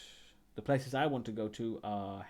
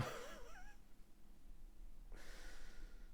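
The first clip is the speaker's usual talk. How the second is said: squeaky laugh; 16 dB quieter than usual. Real, not acted. Laughs after awkward "maybe not.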